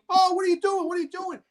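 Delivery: high, startled outcry; alarmed bystanders. Retold or imitated. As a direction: imitate people shouting in alarm.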